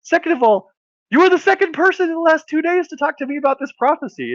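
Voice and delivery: very high, comic squealed rant; flabbergasted. Acted. Woman tells dog he is second prophecy-bearer, flabbergasted. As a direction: keep the voice way up in acted disbelief.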